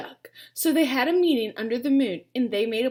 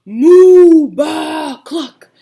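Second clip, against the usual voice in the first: loud moo, baa and cluck; loud animal shouts; 3 semitones higher, 20 dB louder, much slower than usual. Acted. Animals shouting under bed.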